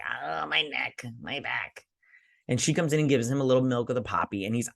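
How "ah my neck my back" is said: put-on slow, pained old king complaining; hammed-up pained king; mock groaning. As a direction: moan out the aches slowly. Sick king voiced.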